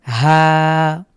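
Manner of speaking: "হা" stretched with the vowel held one alif; long drawn-out vowel. Shown as a demonstration. Teacher stretches "হা" for the class. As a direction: hold the aa in "হা" steadily. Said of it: "খাড়া জবর".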